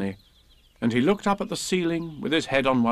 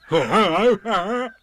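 high, loud tiger hello wrapped in laughs; laugh, call hello, laugh; bouncy storybook tiger; Tigger; 8 semitones higher, 6 dB louder than usual. A narrator's reading. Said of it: "said Tigger".

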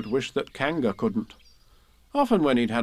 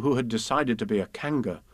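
plain reading voice

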